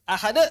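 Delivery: ahad with a hard, loud jolt on the d; ahad, abrupt catch after the bounce; jerked ending. Shown as a demonstration. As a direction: show the mistake of jerking ahad. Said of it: "itu enggak".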